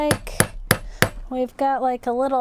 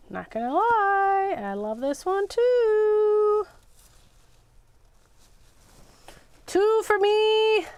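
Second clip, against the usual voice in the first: playful glee; 6 semitones higher, slower than usual. Real, not acted. Coveting a charm, playful.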